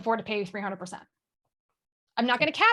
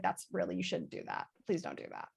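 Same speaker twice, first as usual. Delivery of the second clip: low, soft mock reproof; husband's mild disapproval; 4 semitones lower, 11 dB quieter than usual. Husband telling her off for the French accent, quietly disapproving. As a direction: imitate a husband's quiet, lowered plea. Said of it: "he gets really mad".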